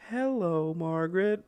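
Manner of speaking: slow, drawn-out teaching voice; mom to child. Retold or imitated. Trainer coaxing dolphins to speak, slow motherly coaxing. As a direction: stretch each word out for a little one. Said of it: "like, a mom going".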